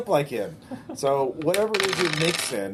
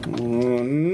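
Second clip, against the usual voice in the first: normal talking voice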